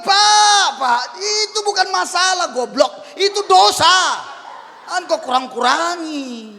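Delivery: loud, high shouted scolding; angry rebuke. Retold or imitated. Pastor shouting at a careless sinner, angry. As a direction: yell that it's a sin, not a problem. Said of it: "Saya bilang".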